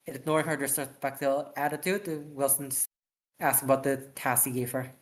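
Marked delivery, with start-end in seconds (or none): none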